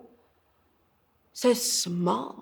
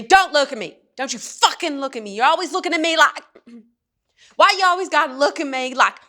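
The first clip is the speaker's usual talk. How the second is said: high, loud, furious tirade, speeding up; screaming; 6 semitones higher, 4 dB louder, much faster than usual. Acted. Father turns on his daughter, screaming at her. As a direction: scream it fast, losing control. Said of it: "turns around and screams at me".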